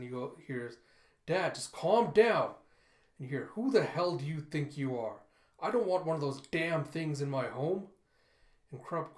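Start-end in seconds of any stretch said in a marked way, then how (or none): 1.3-2.5 loud, higher, drawn-out exasperated plea; raised voice. Acted. Son heard through the door arguing with Dad, raised voice. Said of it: "he hears raised voices". 3.5-7.9 angry, louder outburst; acted indignant tirade from an old father; raised voice. Old father arguing with his son behind the door, raised voice. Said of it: "he hears raised voices".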